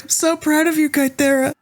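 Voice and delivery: high-pitched, proud cheer from afar; proud call. Acted. Ambrose to Kythera from the other room, proud high cheer. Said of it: "From the other room, Ambrose is".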